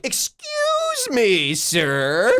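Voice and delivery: high, drawn-out mock politeness; kids' mocking imitation. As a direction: stretch it out to tease. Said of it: "pretending to do the walk of this person".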